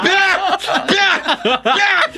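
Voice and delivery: high, breathless laughter; laughing. Real, not acted. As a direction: crack up at the joke. Cracking up at joke.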